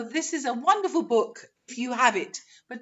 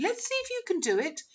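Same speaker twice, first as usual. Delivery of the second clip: ordinary delivery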